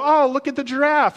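high, gushing onlooker; mock wonder, pitched high; excited zoo visitor. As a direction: imitate an awestruck passer-by at the zoo. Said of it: "somebody would come up and go".

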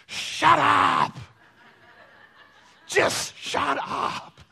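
drawn-out, high, loud yell; frustrated yell. Retold or imitated. Lost son yelling at the noisy creek, frustrated yell. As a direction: yell it out at the noise, stretching the words. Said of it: "he yells at the creek".

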